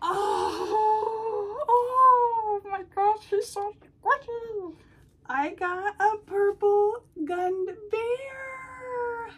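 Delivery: high, slow, tearful gushing; tearful delight. Real, not acted. Hugging a plush bear from Gwen, high tearful delight. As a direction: melt over the squishy bear.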